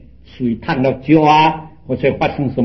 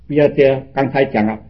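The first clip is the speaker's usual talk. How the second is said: ordinary delivery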